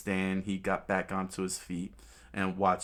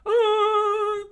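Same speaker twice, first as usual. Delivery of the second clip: loud, very high cry; drawn-out falsetto "ah"; pained shriek; 9 dB louder than usual. Acted. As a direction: scream in cartoon pain. Fighter is struck.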